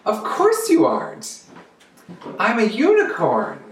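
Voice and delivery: of course, everyone knew; friends' voice. Acted. Narwhal friends shrug off Kelp's news, high and breezy.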